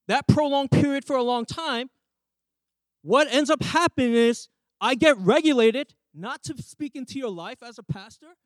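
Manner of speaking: ordinary talk, nothing marked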